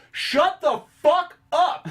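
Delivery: high, loud, drawn-out mock shout; imitated angry yell. Audience yelling at noisy moviegoers, imitated shout. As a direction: holler it across a theater. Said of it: "are yelling".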